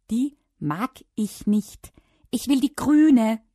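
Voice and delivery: slow, loud, high insistence; stubborn protest. A narrator's reading. Child rejecting the dark blue trunks, loudly defiant.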